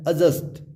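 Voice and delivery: एडजस्ट said as "a-just"; एडजस्ट with just a j sound, no D; silent D. Shown as a demonstration. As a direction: leave out the D in एडजस्ट. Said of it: "सही pronunciation है".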